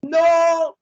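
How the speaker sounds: high, loud, drawn-out no; dismayed shout. Real, not acted. Gamer losing his place at the finish, dismayed shout. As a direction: cry out as the win slips away.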